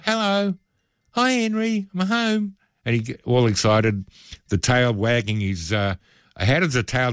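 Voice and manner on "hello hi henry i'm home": put-on high, sing-song baby talk to the dog; much higher greeting voice for a happy pet; funny dog voice. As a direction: coo at your dog like you just got home. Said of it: "we put on that funny dog voice".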